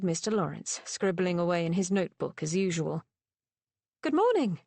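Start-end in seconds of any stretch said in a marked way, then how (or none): 4.0-4.6 higher, cheerful call again; repeated greeting. A narrator's reading. Woman repeating her greeting to Thane, cheerful. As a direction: greet him once more, brightly. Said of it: "she repeated".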